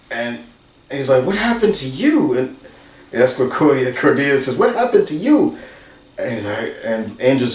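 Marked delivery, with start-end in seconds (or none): 1.3-2.4 much higher, startled asking; a mock worried pitch jump; surprised question. Friends wake knocked-out Angel. 4.6-5.6 much higher, rising in surprise; a mock shocked squeak of concern; surprised Cordelia. Cordelia asks dazed Angel.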